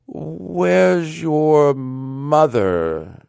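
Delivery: high, very slow, hesitant question; faltering voice. A narrator's reading. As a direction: draw each word out, dreading the answer. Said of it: "put it with a faltering voice".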